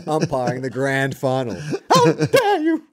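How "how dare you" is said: shrill high prim outrage, laughing through it; high mock-outraged gasp at the ruffians; pearl clutcher. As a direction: play a scandalised fifties school mum. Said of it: "a nineteen fifties school mum".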